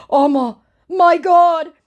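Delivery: high, loud, drawn-out shock; hammed-up aghast exclamation; mock horror. Scammer hears of homeless victims, mock horror.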